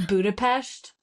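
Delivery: "Budapest" with a sh sound before the final t; pedantic pronunciation. Shown as a demonstration. Co-host teasing the reader, "Budapest" said as Budapesht. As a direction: correct them with "Budapest" ending in -pesht. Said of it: "insist on using the correct pronunciation".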